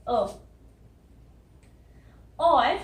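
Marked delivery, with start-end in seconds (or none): 0.1-0.3 'अ' as a short neutral schwa; vowel demo. Shown as a demonstration. Teacher contrasts America with Africa, neutral 'अ'. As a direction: isolate a plain 'अ', unlike 'ae'. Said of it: "यहाँ पे ए की sound".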